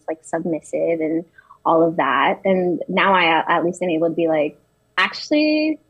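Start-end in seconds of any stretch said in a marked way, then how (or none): 5.0-5.8 high-pitched, drawn-out correction; acted polite pushback. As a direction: push back in a higher voice, stretching the words. Voices standing up to stereotypes.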